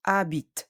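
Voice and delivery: "Habite" ending on a released T; T heard, E silent. Shown as a demonstration. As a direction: sound the final T of "Habite", no vowel after. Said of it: "but we hear the".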